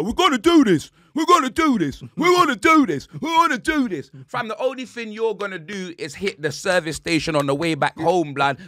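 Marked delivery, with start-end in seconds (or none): 0.0-4.1 higher, louder, chanted hype; mock hyped fan. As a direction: pump it up like a deluded fan.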